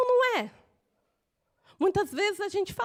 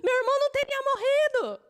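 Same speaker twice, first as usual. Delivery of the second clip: higher, stumbling, agitated plea; flustered Martha; 6 semitones higher than usual. Retold or imitated. Martha rushing to Jesus, higher and stammering. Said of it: "Alvoroçada".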